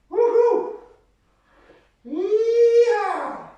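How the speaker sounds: high loud cheering whoop; excited whoop. Real, not acted. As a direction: whoop it up, full of energy. Coach cheering mid workout.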